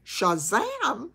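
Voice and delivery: a second goofy Gomer Pyle shout; Gomer Pyle catchphrase. Retold or imitated. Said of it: "Gomer Pyle".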